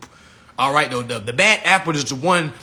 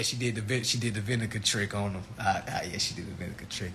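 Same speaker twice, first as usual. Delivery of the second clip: much softer, low and secretive; hushed gossip; 7 semitones lower, 12 dB quieter than usual. Acted. Comic confiding the vinegar trick to the crowd, hushed. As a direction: let them in on a secret.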